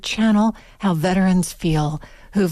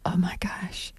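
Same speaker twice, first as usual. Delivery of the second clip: breathless, whispered disbelief; shocked whisper; 9 dB quieter than usual. Real, not acted. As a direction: whisper it, stunned. Host reacts in shock.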